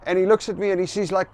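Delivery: normal talking voice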